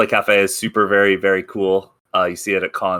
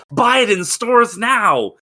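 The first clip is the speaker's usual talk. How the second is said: ad read; 11 semitones higher, 4 dB louder, slower than usual. Pretend. Mock ad plug.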